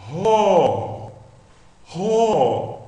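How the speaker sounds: RO opened with a breathed English H, drawn out; aspirated H. Shown as a demonstration. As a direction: say RO with an audible English-style H. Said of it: "aquele Hzinho do inglês".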